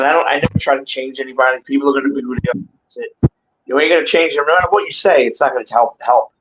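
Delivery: ordinary talk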